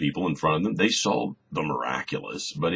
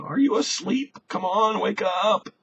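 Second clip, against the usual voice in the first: very high, loud exasperated plea; desperate wake-up call; 4 dB louder than usual. Acted. Believers in trouble calling on a silent God, high exasperated plea. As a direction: beg like someone shaking a sleeper awake.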